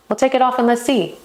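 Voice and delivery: high, fast cheeky suggestion; cheeky flirt. Shown as a demonstration. Teasing a man to take his shirt off, cheekily. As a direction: push the tease over the line. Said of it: "flirt role continues".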